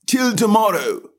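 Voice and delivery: high, indifferent servant voice; a bored doorman brushing her off; dull footman. A narrator's reading. Footman remarks.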